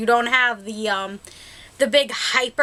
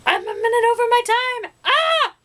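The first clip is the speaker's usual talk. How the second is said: sudden high, fast alarmed exclamation; startled realisation; 11 semitones higher than usual. Real, not acted. Vlogger realising she has run over time, startled and high. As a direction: catch yourself running late, pitched way up.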